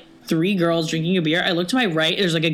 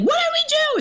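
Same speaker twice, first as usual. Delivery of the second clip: very high-pitched squeal; mock scream. Friend screaming at bouncer while being thrown out, mock shriek. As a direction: imitate a friend shrieking in protest. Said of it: "like, screaming".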